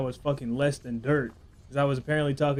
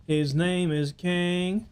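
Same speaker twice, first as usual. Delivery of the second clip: slow put-on voice; 4 semitones higher, much slower than usual. Slow put-on meme line.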